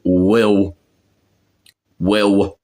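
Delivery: "will" with a dark w for the L; Cockney pronunciation. Shown as a demonstration. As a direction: round "will" off as "wiw". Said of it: "that's how they say it".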